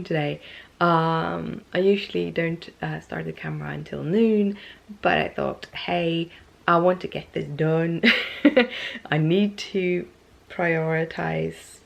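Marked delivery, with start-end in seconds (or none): none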